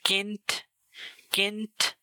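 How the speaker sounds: किंत with a crisp k, pitched high; a clean k to open किंत; hard k sound. Shown as a demonstration. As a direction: hit the k in किंत. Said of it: "pronounce किया जाता है का".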